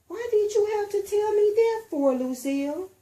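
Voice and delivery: put-on high, loud grouchy child; upset little girl scolding her friend. A narrator's reading. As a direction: snap it crossly at your friend. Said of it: "kind of grouchy".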